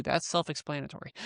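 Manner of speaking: ordinary talk, nothing marked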